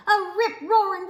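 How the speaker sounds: ordinary delivery, nothing marked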